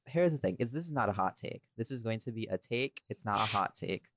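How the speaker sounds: ordinary delivery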